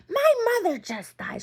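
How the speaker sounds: high, loud mock scream; imitating aunt's scream. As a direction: shriek the threat like the aunt. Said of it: "She's screaming this at me".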